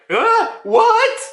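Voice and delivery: very high, startled mock cry; squeaky-high double take; shocked reaction. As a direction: imitate stunned disbelief. Giants' shocked reaction acted out.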